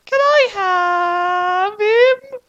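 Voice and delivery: very high, slow crying whine; whiny falsetto. Acted. Doorman begging Light's mom for the dog.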